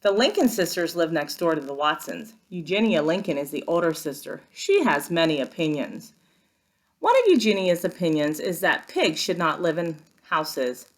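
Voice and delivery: ordinary delivery, nothing marked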